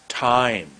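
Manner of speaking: "Time" with a long, falling vowel; a long, deliberate "Time"; lengthened word. Shown as a demonstration. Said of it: "Look how long that word is".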